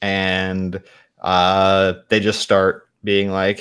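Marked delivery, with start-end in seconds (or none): none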